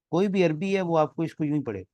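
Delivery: ordinary talk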